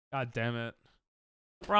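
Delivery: ordinary delivery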